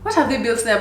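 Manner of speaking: normal talking voice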